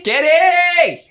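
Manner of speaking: high, loud call. Acted. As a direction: yell the name into the house. Calling out for friend.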